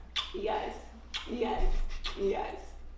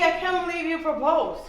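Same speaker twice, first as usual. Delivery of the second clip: ordinary delivery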